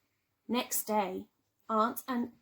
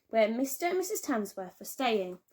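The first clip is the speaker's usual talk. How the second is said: usual speaking voice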